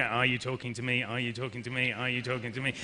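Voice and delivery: low, soft monotone repeats; mechanical repetition. Shown as a demonstration. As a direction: drone it identically each time, low and unvaried. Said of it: "You didn't just go".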